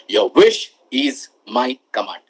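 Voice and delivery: deep, slow genie voice; slow, low and grand. Retold or imitated. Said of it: "genie always says".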